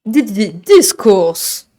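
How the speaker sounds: slow stuttered jingle, stretched final word. Shown as a demonstration. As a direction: stutter in, then hold the name long. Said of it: "la proposition de Mogo". (jingle bégayé, très étiré)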